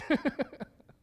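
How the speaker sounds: a real burst of laughter, high and loud; laughing. Real, not acted. Laughs at own story.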